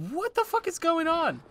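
real disbelieving cry. Real, not acted. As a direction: pitch up in real disbelief at the chaos. Chaotic fight in game.

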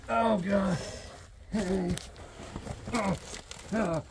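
straining groan under the house; groaning while crawling; effort grunt. Real, not acted. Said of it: "sorry for all the grunting".

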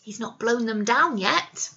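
ordinary delivery

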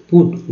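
पुट, its U voiced as oo; oo vowel. Shown as a demonstration. Teacher showing learners U in पुट, oo sound. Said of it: "ऊ के रूप में हुआ".

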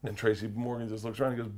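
ordinary talk, nothing marked

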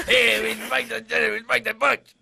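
goofy troll doing an impression. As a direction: put on a dragged-out troll voice. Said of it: "it's your troll voice".

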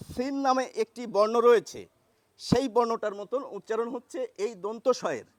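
normal talking voice

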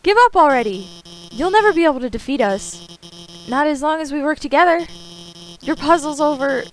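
high heroic taunt; defiant. Acted. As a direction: bold confident hero. Defiant hero voice.

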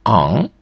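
"ан" as a French nasal a, n not sounded; French nasal vowel. Shown as a demonstration. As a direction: model "ан" as at the word's end. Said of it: "воно також читається як".

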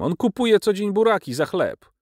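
high-pitched, scornful brush-off; contemptuous dismissal. A narrator's reading. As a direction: dismiss him with lofty contempt. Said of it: "z pogardliwym lekceważeniem".

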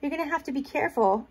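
ordinary talk, nothing marked

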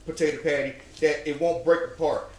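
ordinary talk

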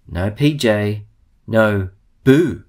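usual speaking voice

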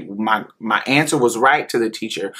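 ordinary delivery

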